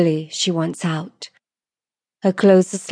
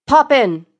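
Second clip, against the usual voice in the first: louder, higher, emphatic vote; collective agreement; 7 semitones higher, 5 dB louder than usual. Acted. Four lords voting as one, emphatic collective agreement. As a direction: call out the verdict decisively. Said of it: "they collectively agree".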